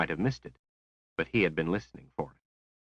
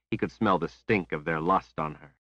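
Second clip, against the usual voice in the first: usual speaking voice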